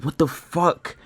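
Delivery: high-pitched exasperated disbelief; exasperated outburst. Retold or imitated. Trader berating himself over his trades, exasperated. Replaying exasperated reaction to own trading.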